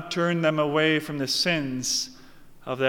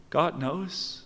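ordinary talk, nothing marked

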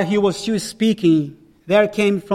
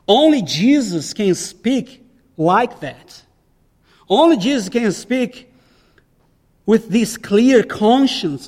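preaching at full force; 4 semitones higher, 4 dB louder than usual. Real, not acted. Pastor proclaiming Jesus' authority, loud and emphatic. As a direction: build the proclamation with weight. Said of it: "preaching the climax".

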